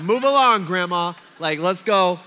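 impatient yell. Retold or imitated. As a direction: yell at grandma to get moving. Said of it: "you know, like".

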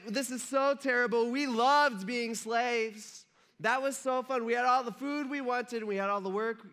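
high-pitched mock whining; whiny. Freed slaves moaning about losing Egypt, whiny. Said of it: "in a really whiny voice".

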